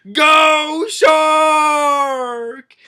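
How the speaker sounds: high, loud, long-drawn yell; shouted rallying cry. Retold or imitated. Pirate urges the shark onward, shouted. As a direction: stretch the last word into a holler.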